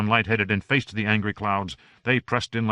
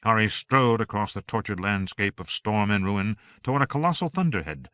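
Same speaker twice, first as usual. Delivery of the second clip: plain reading voice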